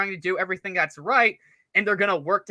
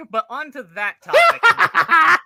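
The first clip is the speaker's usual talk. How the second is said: loud, higher, slow words through real laughter; laughing shout; 8 semitones higher, 8 dB louder, slower than usual. Real, not acted. Cohost laughing loudly at the teasing as he tries to change topic. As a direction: burst out laughing mid-sentence. Cracking up, restarting his point.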